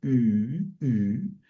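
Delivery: U drawn out as a rounded ee, like French u; Greek upsilon sound. Shown as a demonstration. Teacher modelling the hard Greek vowel, U as rounded i. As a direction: say U with lips rounded for u, tongue at i. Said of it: "no quieren pronunciar U".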